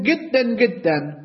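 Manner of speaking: گدن said with a hard g where Persian has ج; Egyptian g for j. Retold or imitated. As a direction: say گدن, Egyptian-style, with a g sound. (گدن با تلفظ مصری، جیم به صورت گاف)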